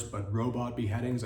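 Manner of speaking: usual speaking voice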